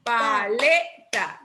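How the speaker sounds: paleta said slowly, syllable by syllable; sílabas separadas. Shown as a demonstration. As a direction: draw out pa, le, ta to test where the stress falls. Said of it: "¿Dónde la lleva?". (paleta dicho lento, sílaba por sílaba)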